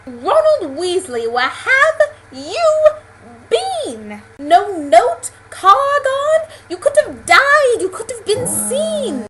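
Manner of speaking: high, loud, drawn-out scolding; British scolding shout. Acted. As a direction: yell at him in a British accent, stretching the words. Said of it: "talking as the Harry Potter characters do".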